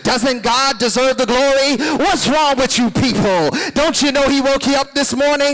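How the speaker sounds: loud, high, berating "What's wrong with you people?"; scolding pastor. Pretend. Mock pastor berating a congregation, loud and high. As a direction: hammer the crowd with blame. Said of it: "pastor who will look into a congregation and say".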